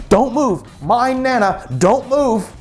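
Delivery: put-on high, urgent order to a child; acted-out bossy grandmother; stern command.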